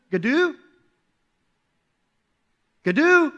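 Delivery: lightly acted searching call, rising in pitch; a mild questioning call; tentative calling out. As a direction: call uncertainly for a missing brother. Lost child calls for brother.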